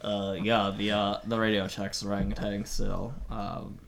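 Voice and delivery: low, deep male voice on radio; Fredericks voice. Acted. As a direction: drop low as Fredericks on walkie talkie. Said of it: "I have his voice".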